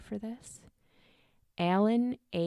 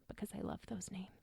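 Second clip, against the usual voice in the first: whisper; 13 dB quieter, faster than usual. Real, not acted. Gushing aside about pet names.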